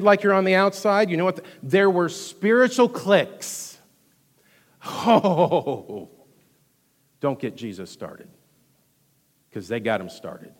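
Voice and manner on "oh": normal talking voice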